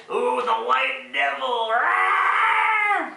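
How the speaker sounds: high, drawn-out ghostly wail into a scream; hammed-up wail rising to a long mock roar; mock spooky scare.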